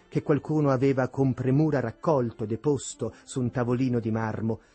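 usual speaking voice

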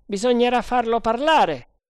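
loud, high-pitched sergeant voice; resolute sergeant. A narrator's reading. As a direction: push it out high and determined. Voce del sergente Bharata nel dialogo. (voce acuta, forte e decisa da sergente)

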